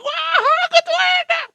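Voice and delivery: very high, loud delighted cry; gleeful master. Acted. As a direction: relish it with cruel pleasure. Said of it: "Majikan senang". (seruan girang majikan)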